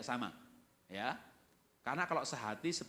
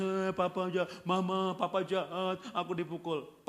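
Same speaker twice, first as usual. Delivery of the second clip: drawn-out, louder whining sobby complaint; mock crying child; 3 semitones higher, 4 dB louder, slower than usual. Child complaining to mama about papa, mock crying. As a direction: wail it like a tattling child, hammed up. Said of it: "Anaknya nangis".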